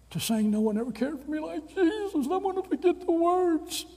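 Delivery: put-on high old professor voice; old man's voice.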